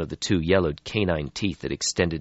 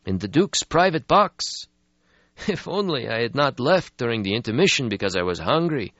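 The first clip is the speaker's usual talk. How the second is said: put-on weary old vampire; 6 semitones higher, 4 dB louder than usual. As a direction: sigh out an ancient regret. Stretsky voiced in story.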